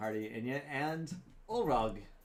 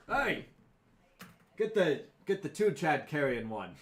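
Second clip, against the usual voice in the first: loud, higher call across the room; 4 semitones higher, 7 dB louder than usual. Acted. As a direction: shout for the staff. Innkeeper calls out to goblins.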